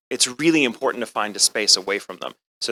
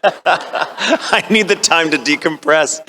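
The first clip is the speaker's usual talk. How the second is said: laughing; 7 semitones higher, much slower than usual. Real, not acted. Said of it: "laughing aside".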